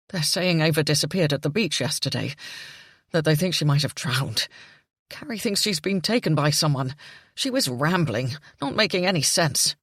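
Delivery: distraught father relaying the news; Jimmy, distraught. A narrator's reading. Jimmy voiced by narrator.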